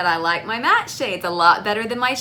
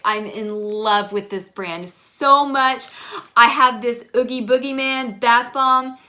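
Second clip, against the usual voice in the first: ordinary delivery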